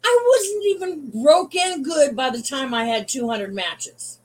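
usual speaking voice

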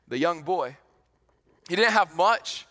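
ordinary talk

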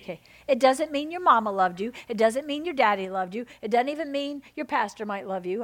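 ordinary talk

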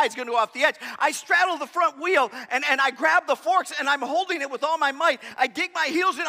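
ordinary delivery

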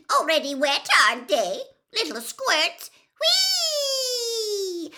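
very high, loud mischievous poltergeist; cackling imp jeer; Peeves. A narrator's reading. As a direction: taunt them shrieking with glee, ending in a long squeal. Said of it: "cackled Peeves".

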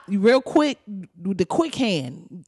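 normal talking voice

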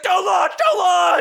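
high-pitched shouted warning; frantic yelling. Retold or imitated. Researcher phoning everyone to stop a launch, frantic yell. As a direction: yell it like a desperate plea. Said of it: "like, yelling".

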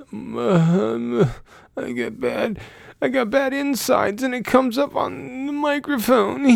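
high, strained mock friend voice; Tom impression. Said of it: "That was my Tom impression".

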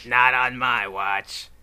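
slow, drawn-out Slinky Dog voice. Pretend. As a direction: stretch it out like the Slinky toy dog. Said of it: "There's your Slinky Dog voice".

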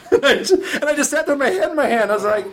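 high, laughing through the words; can't stop laughing while telling it. Real, not acted. Laughing at the memory.

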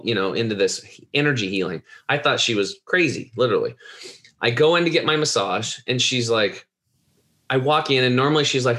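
usual speaking voice

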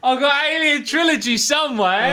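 loud and far off the mic; calling from across room. Real, not acted. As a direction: shout from the other side of the room.

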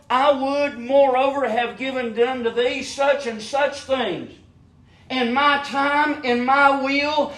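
preaching at full force. Acted. Preacher urges the flock to ask God, preaching at full force. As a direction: lift it up and preach.

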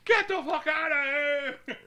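high, slow drawn-out shout; mock yell. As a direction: run the kid off. President yelling at kid.

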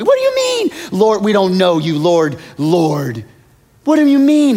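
very high, loud indignant protest; surprised crowd. Acted. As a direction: protest in shocked disbelief. Voicing surprised people.

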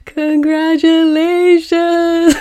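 high, loud, slow mock sob of Congratulations; a hammed-up imitation of [name] crying. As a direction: wail it like the crying [name] meme. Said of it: "the [name] cry".

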